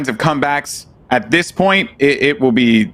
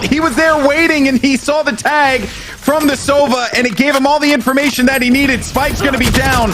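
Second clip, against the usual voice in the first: esports caster; 9 semitones higher than usual. Real, not acted. Live play-by-play rising.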